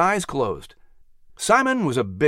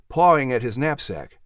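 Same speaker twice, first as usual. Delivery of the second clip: ordinary delivery, nothing marked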